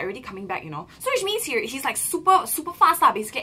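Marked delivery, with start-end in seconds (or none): none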